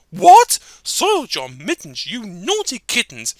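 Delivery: sharp loud scolding mother; scolding mother cat. Acted. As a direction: shout at the naughty kittens.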